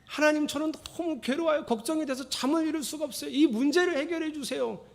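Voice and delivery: high, anxious pleading prayer; wrong way to pray. Shown as a demonstration. Pastor shows the wrong prayer, anxious high plea. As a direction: beg God in a high worried voice. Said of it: "이렇게 기도하지 말고".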